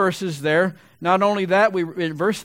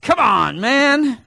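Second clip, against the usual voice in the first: incredulous, louder; Chris Carter catchphrase; 6 semitones higher, 6 dB louder, much slower than usual. Retold or imitated. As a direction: pitch jumps high on man, mocking disbelief. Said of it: "Chris Carter".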